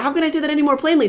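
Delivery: exasperated. Real, not acted. Exasperated with dad.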